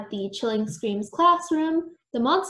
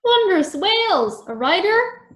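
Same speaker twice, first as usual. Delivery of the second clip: high, loud amazed outburst; gushing, high-pitched delight; excited; 9 semitones higher, 6 dB louder, slower than usual. A narrator's reading. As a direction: exclaim in thrilled astonishment, stretching the words. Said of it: "Professor Doubloon looks excited".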